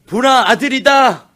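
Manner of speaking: slow, high and loud, stressing 아들이다; proclaiming. Acted. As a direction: announce the son with wonder. Proclaiming Leah's words again. (레아가 첫 아들을 얻고 외치듯, 느리고 크게)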